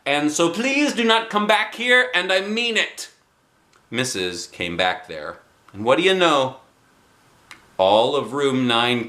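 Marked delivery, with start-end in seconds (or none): 0.1-3.1 put-on pleading girl. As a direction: beg her not to come back. Junie B. pleading with teacher.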